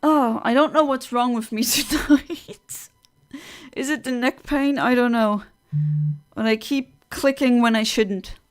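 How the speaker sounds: ordinary talk, nothing marked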